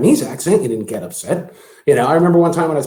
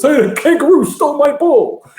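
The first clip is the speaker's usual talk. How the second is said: loud, high-pitched movie-character outcry; Rodney Dangerfield; 13 semitones higher, 6 dB louder than usual. Acted. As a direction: yell it like an outraged comic golfer. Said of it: "when Rodney Dangerfield and the gopher".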